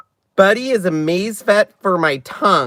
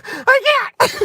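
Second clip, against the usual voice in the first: high squeal through a laughing fit; can't speak for laughing; helpless laughter. Real, not acted. Helpless laughter at packaging text.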